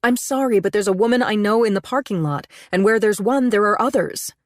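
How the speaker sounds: agitated pleading. A narrator's reading. Woman anxious that her lover is seen, agitated. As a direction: fret aloud about being seen, pitch up. Panicked narrator pleads with Aiden.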